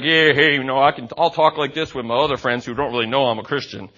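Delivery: a lightly voiced shrug of a hypocrite. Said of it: "I'd be like".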